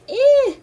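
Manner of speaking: high loud grossed-out eh; disgusted eh. Shown as a demonstration. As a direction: yelp like stepping in poop. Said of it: "Like the girl that stepped in poop".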